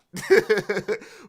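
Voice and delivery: loud, high burst of laughter. Real, not acted. Streamer laughing at himself after cursing Kevin Feige, loud laugh. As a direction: laugh at his own outburst.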